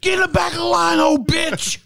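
high, loud mock shout; kid's shout. Man repeating the kid's shout for his friend, high shout. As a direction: yell it like a boy who snapped. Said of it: "That's what he said".

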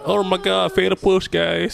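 high gushing hype; excited player. Retold or imitated. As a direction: gush like a hyped player. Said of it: "everybody's like".

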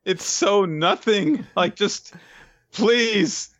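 high, slow, drawn-out whine; exasperated pleading. Real, not acted. Reviewer begs to read better manga, whining plea. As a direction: beg in drawn-out despair.

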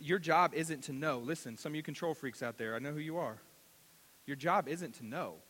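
normal talking voice

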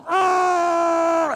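high, loud mock honk; shouted imitation of a horn; mock horn blast. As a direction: blare like a car horn. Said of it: "I just lay on the horn".

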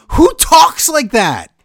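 high, loud, slow exasperated cry; real exasperation, loud and high; incredulous outburst. Real, not acted. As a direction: shout it in disbelief. Outraged rant at wrestler.

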